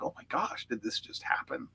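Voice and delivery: quiet, breathy mock amazement; hushed disbelief. Family stunned watching at home, hushed disbelief. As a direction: say it under your breath, astonished.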